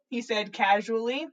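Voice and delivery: plain reading voice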